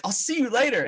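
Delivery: very high, amused replay; mock parting shot. Storyteller mocks his own useless goodbye, high and amused. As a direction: squeak out the goodbye in self-mockery.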